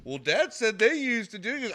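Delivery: put-on high, loud whiny kid voice; kids' excuse. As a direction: blame Dad like a caught kid. Boys blaming dad.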